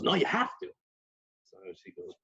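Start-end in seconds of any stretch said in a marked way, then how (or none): 0.0-0.7 insisting husband. Retold or imitated.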